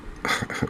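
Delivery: brief, soft breath of a laugh; real laugh. Real, not acted. Angler gets eel on second toss, small laugh. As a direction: let out a small rueful laugh.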